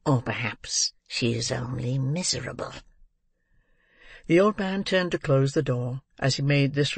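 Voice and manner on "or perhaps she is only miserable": put-on old man musing. A narrator's reading. Old man musing to himself about the girl, half-whispered. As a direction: muse under your breath as a sly old man. Old man's reflection in story.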